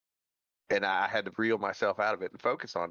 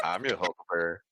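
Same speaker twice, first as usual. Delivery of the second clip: Doc Holliday drawl; 3 semitones lower than usual. Pretend. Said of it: "the Doc Holliday accent".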